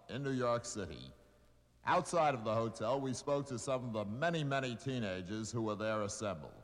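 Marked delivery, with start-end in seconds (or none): none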